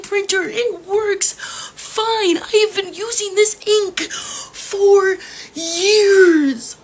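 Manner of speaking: real, wailing frustration, words dragged out; tearful exasperation. Real, not acted. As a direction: plead in tearful disbelief, stretching the key words. Exasperated rant about rejected ink.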